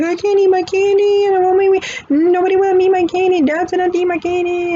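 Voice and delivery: put-on high, loud whining little-girl voice; high pitched, loud childish whine; whiny upset daughter. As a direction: whine it like a sulking kid who won't eat her candy. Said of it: "She's like".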